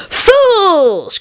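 high, scornful witch call; witch's cry. A narrator's reading. Babbity mocking the king's men, high cry. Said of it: "cried Babbity's voice from the stump".

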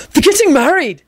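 high-pitched excited announcement; happy hiss. A narrator's reading. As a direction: let the happy secret burst out. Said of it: "he hissed happily".

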